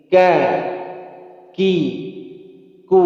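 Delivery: Ka, ki, ku with the K pressed and held before release; clean, deliberate syllables; crisp kaf. Shown as a demonstration. Teacher demonstrating kaf syllables for students to copy, crisp. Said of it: "Ulangi lagi".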